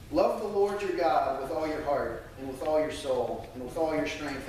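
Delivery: ordinary talk, nothing marked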